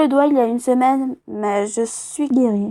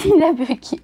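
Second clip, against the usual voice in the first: laughing while she says it; amused giggling words; 4 semitones higher than usual. Real, not acted. Game froze again, laughing.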